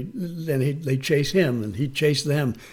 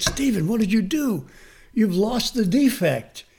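high-pitched retold astonishment; amazed; 7 semitones higher than usual. Teacher to former student who lost his defect, amazed. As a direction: marvel at it, voice pitched right up.

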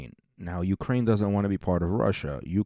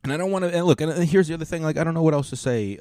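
energized; 9 semitones higher, 4 dB louder, faster than usual. Real, not acted. Sudden energetic restart.

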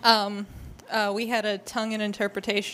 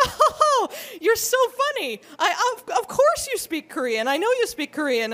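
high, loud mock chuckle settling into insistence; imitated excited amusement; 9 semitones higher, 4 dB louder than usual. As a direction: play the delighted woman who won't believe it. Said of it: "very excitedly".

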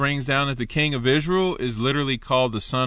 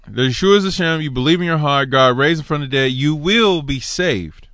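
louder, emphatic reading of the verse; scripture reading; 3 semitones higher, 5 dB louder than usual. Acted. Preacher reads Romans ten nine to his congregation, loud and emphatic. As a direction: proclaim it to the room.

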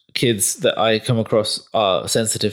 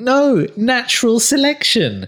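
slow, higher, solemn pronouncement; mock doom voice; 11 semitones higher, slower than usual. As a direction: intone it like a grim prophet. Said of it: "I was watching the trailer thinking".

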